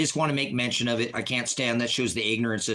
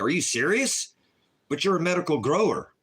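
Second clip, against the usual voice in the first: higher, mock-disbelieving voice; imitating incredulous people; 4 semitones higher than usual. Streamer imitates people doubting him, mock disbelief. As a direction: play the shocked doubters a bit. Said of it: "And everybody's like".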